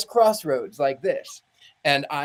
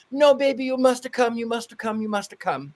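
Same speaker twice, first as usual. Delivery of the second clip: mock Italian pleading, pitched high; Italian accent; 7 semitones higher than usual. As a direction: imitate an Italian friend begging, repeating it as you fade. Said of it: "my friend who's Italian".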